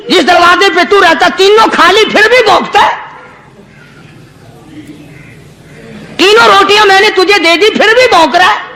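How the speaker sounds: high-pitched indignant rant at the dog; holy man telling off the dog in anger; angry scolding. Retold or imitated. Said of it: "غصے میں آ گیا اور کہنے لگا".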